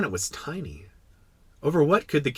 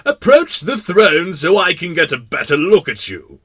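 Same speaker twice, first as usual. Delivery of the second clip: loud, higher, commanding royal voice; proud king; 8 semitones higher, 11 dB louder than usual. Acted. King to his only subject at last, proud. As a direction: summon them proudly to your throne. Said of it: "very proud of being a king".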